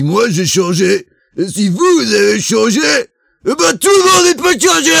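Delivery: slow rising speech, ending high and loud; hammed-up film dubbing speech, climbing to a shout; Alain Dorval. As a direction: build to a big, drawn-out high cry. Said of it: "très bon Alain Dorval".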